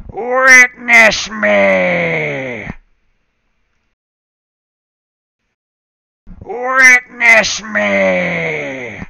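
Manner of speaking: slow, drawn-out loud creepy chant; creepy acolyte voice. Acted. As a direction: stretch each word like a cultist's call. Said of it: "Sound like a creepy acolyte voice".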